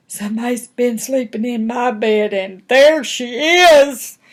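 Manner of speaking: high little bear, building to a loud wail; shocked child bear pointing out the culprit; Baby Bear. Acted. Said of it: "and he said".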